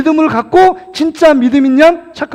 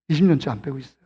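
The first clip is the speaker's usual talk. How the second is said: low, soft repeat; subdued admission; 8 semitones lower, 7 dB quieter, faster than usual. Real, not acted. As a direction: drop right down, admit it quietly. Repeats the confession, dropped low.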